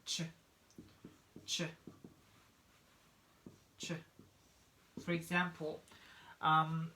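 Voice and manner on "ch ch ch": ch said as a short crisp burst; ch sound. Shown as a demonstration. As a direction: model the ch sound for the class. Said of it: "this one gonna be ch".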